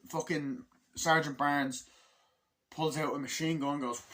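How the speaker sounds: normal talking voice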